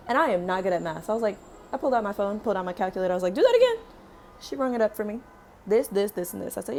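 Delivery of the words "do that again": high loud disbelieving demand; shocked demand. Retold or imitated. Demanding recount from broker.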